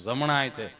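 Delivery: low-pitched patient voice; Gabho. Acted. Patient answering the nurse about his arm, in a low voice. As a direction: drop the pitch for a short answer. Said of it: "અહીંયા ગભો બેઠો હતો".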